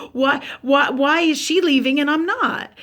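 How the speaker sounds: exasperated demand. Retold or imitated. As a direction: demand an answer, why her and not me.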